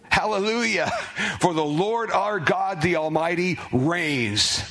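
high ringing proclamation, Hallelujah lifted up; joyful exclamation. Acted. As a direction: cry it out like a great multitude praising. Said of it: "Exclamation mark".

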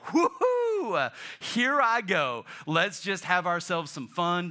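hammed-up carefree celebration; mock glee. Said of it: "is like".

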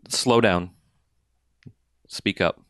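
usual speaking voice

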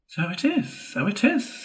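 the mole heartily agreeing with the rat; hearty agreement. A narrator's reading. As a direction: agree with great heartiness, voice jumping up. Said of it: "said the mole with great heartiness".

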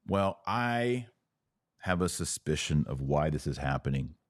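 normal talking voice

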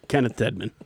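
normal talking voice

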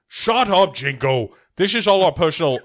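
loud, high-pitched interrupting impression; mock-irritated Scottish star impression; Sean Connery. Cutting in on Jingo's story, mock irritation. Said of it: "Connery interrupts to be like".

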